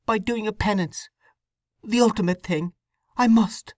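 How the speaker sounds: put-on a troubled young wife confessing; young woman Sue. Sue insists on penance.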